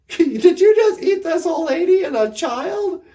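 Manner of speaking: asking through a laugh; laughing woodsman. Acted. Woodsman asks the wolf, laughing. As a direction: laugh out the question. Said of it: "he comes walking in".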